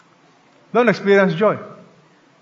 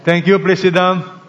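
ordinary talk

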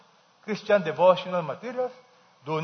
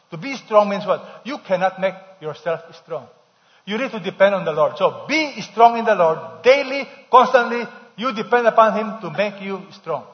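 full-force preaching; 2 semitones higher, 7 dB louder than usual. Acted. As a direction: build the point to a proclaiming peak.

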